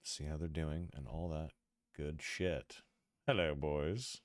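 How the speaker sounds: normal talking voice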